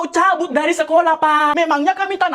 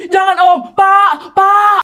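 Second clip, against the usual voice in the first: high panicked shout for dad; a child shouting for his father; calling for help; 4 semitones higher, 6 dB louder, much slower than usual. Acted. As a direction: yell for help, scared kid. Kid calls for help in fight.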